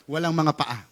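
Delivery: ordinary talk, nothing marked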